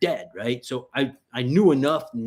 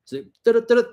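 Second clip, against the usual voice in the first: high mock radio beeping; quick high imitated radio chirp; walkie-talkie chirp; 11 semitones higher, 6 dB louder, faster than usual.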